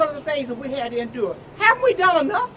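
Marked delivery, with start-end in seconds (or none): 1.6-2.5 high, loud, pleading cry; rising to a passionate peak; impassioned plea. Real, not acted. Impassioned climax.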